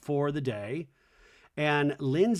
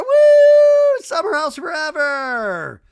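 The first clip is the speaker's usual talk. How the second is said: very high, very loud whooping yell; exuberant shout; 15 dB louder, slower than usual. Retold or imitated. Recapping two guys jumping into the pool, jubilant scream. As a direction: build into a huge party holler, stretching the ends.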